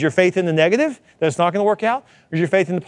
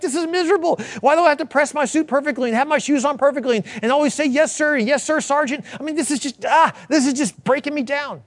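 higher, whiny griping recruit; whining new recruit; 8 semitones higher than usual. Retold or imitated. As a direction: moan like a miserable boot camp recruit. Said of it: "You're like".

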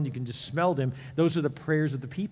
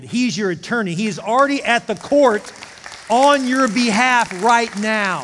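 loud, high, driving preacher voice; fervent preaching; 5 semitones higher, 8 dB louder than usual. Acted. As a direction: preach it out to the whole church. Preaching at full force.

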